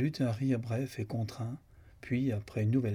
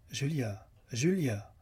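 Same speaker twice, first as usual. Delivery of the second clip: raised, almost hard call, falling on the name; loud, almost hard; 3 semitones higher, slower than usual. Acted. Lucan calling the unconscious Julia, loud and almost hard. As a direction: call her name firmly, second time more forcefully. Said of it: "d'une voix haute et presque dure".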